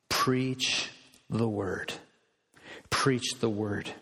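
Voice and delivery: low, slow PREACH, pause, THE WORD; solemn emphasis. Real, not acted. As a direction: drop the pitch and weigh out "preach the word". Solemn repeat of seminary's key charge.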